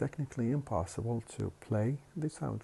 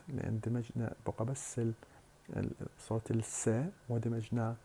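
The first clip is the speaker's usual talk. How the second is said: usual speaking voice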